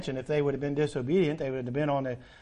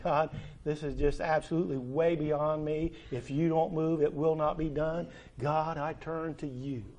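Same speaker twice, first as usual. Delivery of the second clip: quiet prayer; 2 semitones lower, 7 dB quieter than usual. Acted. Believer pleading to God in prayer.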